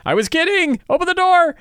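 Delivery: very high, squealing plea; high squeal begging them to open the door; squeaky panic. Retold or imitated. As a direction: squeak it out like a terrified kid. Squeaky joke pleading.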